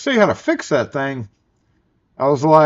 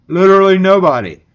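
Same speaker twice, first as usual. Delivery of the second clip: louder, higher punchline; emphatic answer; 4 semitones higher, 6 dB louder than usual. Real, not acted. Answering his own question to chat, loud and emphatic. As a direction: hit it hard and final.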